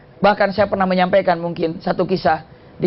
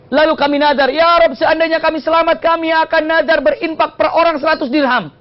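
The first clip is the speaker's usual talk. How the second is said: high, urgent prayer; desperate vow; 8 semitones higher than usual. Acted. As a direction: call out to God from a sinking ship. Merchants' desperate vow at sea.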